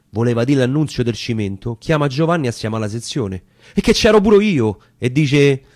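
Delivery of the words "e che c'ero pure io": much higher, louder outburst; eager cry. Acted. Young volunteer crying out to join the section, loud and eager. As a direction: shout that you're in too.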